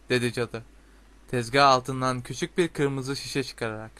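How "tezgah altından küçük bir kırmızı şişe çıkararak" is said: usual speaking voice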